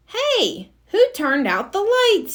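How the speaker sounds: a hero caught off guard in smoke; startled. A narrator's reading. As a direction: jolt up in surprise.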